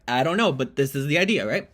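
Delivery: ordinary delivery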